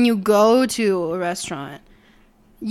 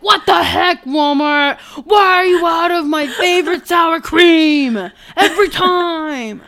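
high, loud hammed-up yelling; mock irate customer; 8 semitones higher, 7 dB louder, slower than usual. Customer walks into the store yelling at Walmart, hammed-up fury. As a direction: rant at full volume in comic outrage.

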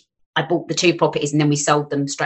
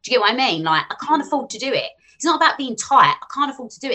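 ordinary delivery

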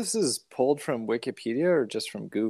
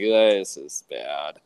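deadpan disgust; 6 semitones lower, 3 dB louder, much slower than usual. Retold or imitated. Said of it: "I'm like".

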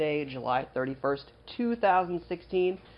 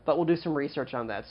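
ordinary delivery

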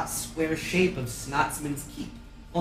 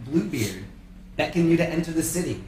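normal talking voice

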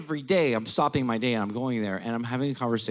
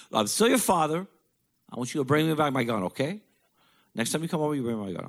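pitched-up dad giving orders; father demanding his gun back, higher; imitating stern father; 8 semitones higher, faster than usual. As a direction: lay down the law as his father.

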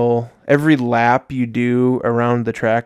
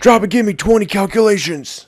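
barking, high and loud; drill sergeant; 9 semitones higher, 5 dB louder than usual. Pretend. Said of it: "he's like a drill, drill sergeant".

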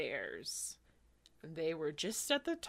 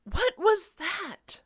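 scared voice; 10 semitones higher, 9 dB louder than usual. Acted. Scared Amy.

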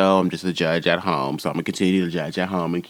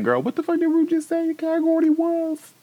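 high laughing disbelief. Retold or imitated. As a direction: squeal it through a laugh, way up high. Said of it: "I was like".

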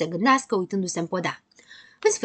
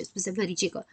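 ordinary delivery

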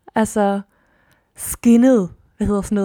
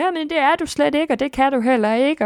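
inner critic voice; 5 semitones higher than usual. Retold or imitated. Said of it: "mit eget ego ind og sagde".

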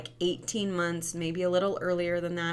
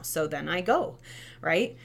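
ordinary delivery

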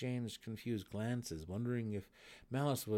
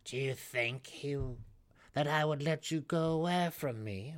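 loud, imperious matriarch; cold matron voice; 8 semitones higher, 7 dB louder than usual. A narrator's reading. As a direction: play the haughty Matron. Malice's voice.

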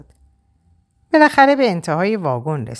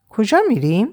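کجا raised, rising in surprise, a bit higher; surprised; 5 semitones higher than usual. Acted. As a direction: echo the question back in disbelief, کجا lifted. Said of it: "با تعجب زیر نظرم گرفت". (تکرار با تعجب، کجا با لحن بالارونده)

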